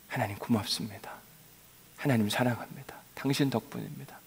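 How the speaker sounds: the longed-for words to God, whispered; whispered prayer. Shown as a demonstration. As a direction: breathe it like a private prayer.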